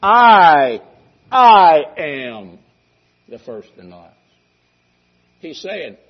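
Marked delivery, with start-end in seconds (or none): 0.0-4.2 booming emphatic I am; emphatic declaration. Shown as a demonstration. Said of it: "it's a very emphatic statement".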